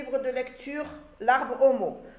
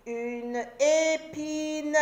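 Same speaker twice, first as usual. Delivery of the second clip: épine étiré lentement; découpe épine en syllabes, lentement; très lent, syllabé; 3 semitones higher, much slower than usual. Shown as a demonstration. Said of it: "syllabé puis normal". (dire « une épine » très lentement)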